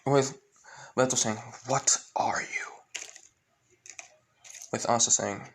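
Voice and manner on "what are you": harsh whispered villain voice; menacing villain. Acted. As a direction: hiss it out softly as a menacing villain. Voicing Veto confronting Asta.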